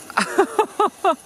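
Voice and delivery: high-pitched burst of laughter; high giggle. Real, not acted. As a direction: giggle helplessly. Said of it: "I'm laughing at my own silliness".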